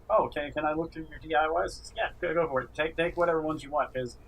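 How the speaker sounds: ordinary talk, nothing marked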